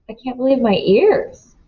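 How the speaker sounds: ordinary delivery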